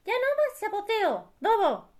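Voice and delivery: high-pitched lamb voice; high cartoon sheep, insistent; little sheep scolding. A narrator's reading. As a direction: tell the wolf off in a tiny high voice. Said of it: "le dijo".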